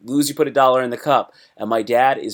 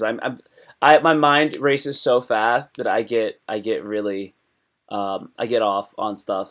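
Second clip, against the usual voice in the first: normal talking voice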